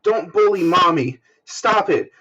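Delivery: put-on high pleading child voice; small boy begging dad to stop; crying little boy. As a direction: beg like a sobbing five-year-old. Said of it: "he's, like, crying in between".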